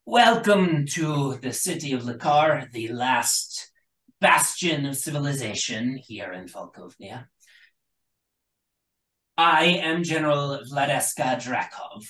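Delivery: harsh-looking general chewing out her soldier; stern general. Acted. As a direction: scold a failing soldier from high above. General addresses the party.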